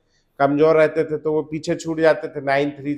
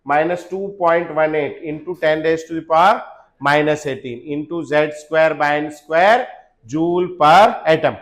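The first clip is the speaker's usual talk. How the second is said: slow spelled-out formula; slow dictation; much slower than usual. Shown as a demonstration. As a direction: say it slowly, word by word. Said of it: "बोलेंगे". (Formula धीरे-धीरे बोलना)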